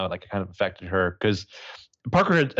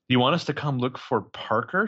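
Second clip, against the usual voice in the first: ordinary delivery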